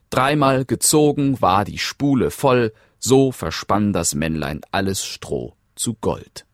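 ordinary delivery, nothing marked

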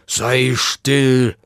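put-on wolf character voice; wolf hushing. A narrator's reading. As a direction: play a wolf demanding silence, drawn out. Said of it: "sagte der Wolf".

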